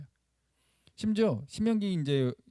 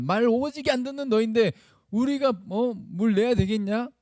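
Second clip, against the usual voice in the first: higher-pitched acted tirade, building to the end; indignant Moses; 5 semitones higher than usual. Moses berating the people before the rock, indignant and rising. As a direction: throw indignant questions at the rebellious crowd. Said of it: "이 말이에요".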